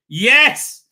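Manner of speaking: loud, high whoop of YES; triumphant yes. Acted. Host cheering Spielberg's catch, triumphant. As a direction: cheer it like a win.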